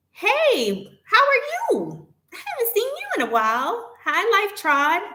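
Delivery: high, sing-song delighted hello; excited greeting. Real, not acted. Streamer greets a returning viewer, excited and high. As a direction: greet a long-lost friend, voice way up high.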